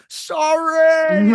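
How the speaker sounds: retelling the yell of a wrestler in a submission hold, mock scream. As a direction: belt it out like a wrestler mid-hold. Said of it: "He's screaming, he's yelling".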